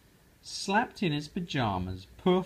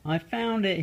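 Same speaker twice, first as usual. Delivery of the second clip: higher, louder happy call; mild yell; 8 semitones higher, 5 dB louder than usual. Acted. Froggy shouting to mother, mild yell. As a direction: call out the find. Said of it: "he yelled".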